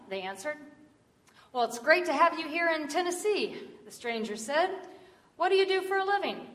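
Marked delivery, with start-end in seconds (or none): 1.5-3.6 higher, hearty welcome; raised, chatty storytelling voice; friendly stranger. Retold or imitated. 5.4-6.5 high, quick chatty question; brisk, raised, curious voice; friendly stranger. Retold or imitated.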